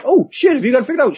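high, startled mock exclamation; hammed-up startled cry; mock surprise. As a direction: jump up in fake shock. Retelling priest's reaction.

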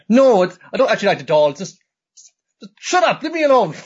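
high, loud cartoon yell; hammed-up yell. Rabbit telling Nodoka to shut up, high and loud. Voicing rabbit shouting back.

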